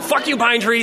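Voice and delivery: exasperated mock curse; mock cussing out. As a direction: cuss at a tree in pretend fury. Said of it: "you just cuss out a pine tree".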